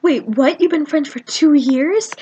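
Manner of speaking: slow, rising disbelief; amazed questioning. Retold or imitated. Colleen learns of the long friendship, amazed questioning. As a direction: act out a stunned double take.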